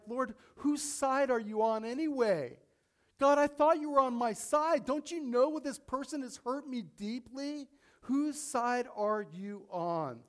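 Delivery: high, loud, wounded protest at God; indignant complaint to God. Retold or imitated. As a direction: cry out to God as if betrayed, slowing on the last question. Imagined complaint prayer to God.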